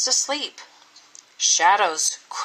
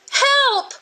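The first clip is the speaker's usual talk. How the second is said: put-on boy shouting; 14 semitones higher than usual. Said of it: "the boy cried again".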